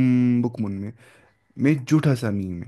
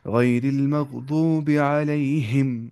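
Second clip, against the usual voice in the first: slow, melodic Quran chant; Quranic recitation; much slower than usual. Shown as a demonstration. Teacher chanting a verse for tajweed students, slow and melodic. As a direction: draw the verse out in a measured chant.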